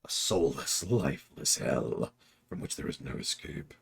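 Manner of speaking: low, soft, slow ominous intonation; dramatic doom voice. Pretend. Joking about Transformers, a low, slow doom voice. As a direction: intone it like a doomed narrator. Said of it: "I shouldn't talk like that".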